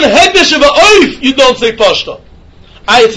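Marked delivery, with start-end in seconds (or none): none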